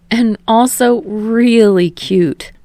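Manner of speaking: drawn-out teasing. A narrator's reading. Kirishima teasing boyfriend.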